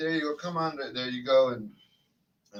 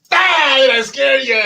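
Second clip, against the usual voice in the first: sudden loud high boo; spooky scare shout; 10 semitones higher, 8 dB louder than usual. Acted. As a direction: jump out and yell it. Grim Reaper costume scaring viewers.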